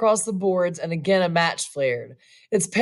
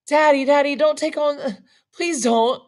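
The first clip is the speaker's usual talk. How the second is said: put-on high, pleading girl's voice, tears held back; trembling, repressed tears; 7 semitones higher than usual. A narrator's reading. Daughter begging her distraught father, trembling with held-back tears. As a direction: plead in a raised, lightly tearful voice. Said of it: "itself trembling with repressed tears".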